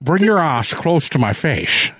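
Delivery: slurred Scottish movie-star impression; thick sh sounds on every s; Sean Connery. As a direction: suave Scotsman, badly faked. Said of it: "a really bad Sean Connery".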